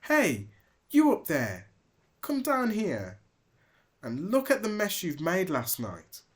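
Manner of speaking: high, loud scolding call; yelling. Acted. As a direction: call him down from the roof. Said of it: "she yelled".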